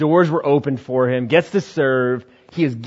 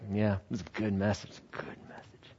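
lower, much softer, fading to a whisper; smug murmur; 5 semitones lower, 14 dB quieter than usual. Acted. Pastor walking off stage, smug whispered murmur. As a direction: savour it under your breath.

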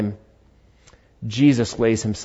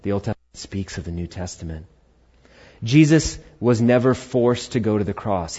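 ordinary talk, nothing marked

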